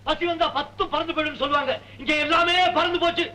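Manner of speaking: high, carrying call to the neighbours; mocking call. Husband calls the neighbours to watch his wife, high mocking call. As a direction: summon everyone to laugh at her.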